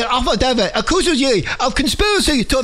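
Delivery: hammed-up Chinese accent. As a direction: read it in a mock Chinese accent. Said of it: "Do it in your Chinese accent".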